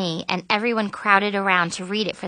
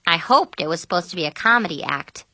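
plain reading voice

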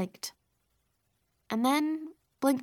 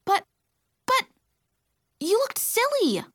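halting start, then high-pitched retort; stammer, then protest defensively; high, exasperated comeback; flustered protest; 12 semitones higher, 4 dB louder than usual. A narrator's reading. Narrator answering back in sisters' quarrel.

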